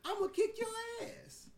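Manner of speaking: put-on daring the kid to act up; defiant taunt. Imagined son's defiance, acted.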